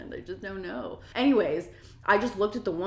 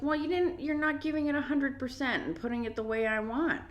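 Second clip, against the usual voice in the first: lightly imitated fussy customer, higher; mock complaining customer; 6 semitones higher than usual. Rude customer criticizing the employee's help, lightly mocked complaint. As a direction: put on a mildly whiny complaint.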